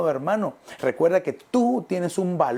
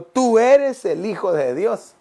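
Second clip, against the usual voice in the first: slow loud cry, pitched up; demons shouting; 6 semitones higher, 5 dB louder, slower than usual. Acted. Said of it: "gritaban diciendo".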